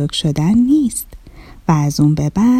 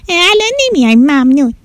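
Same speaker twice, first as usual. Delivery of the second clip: put-on higher, giggly child's voice holding back a laugh; gleeful refusal; 5 semitones higher than usual. A narrator's reading. Child teasing her mother by refusing lunch, giggly. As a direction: turn down lunch with cheeky glee. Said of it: "آدیشه تو دلش ذوق کرد".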